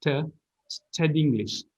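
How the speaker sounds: "to" as "tuh", "study English" run together; joined "study English" after a weak "to"; linked reduced form. Shown as a demonstration. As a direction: weaken "to" and glide "study" into "English". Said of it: "this O becomes kind of a".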